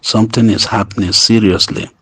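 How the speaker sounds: normal talking voice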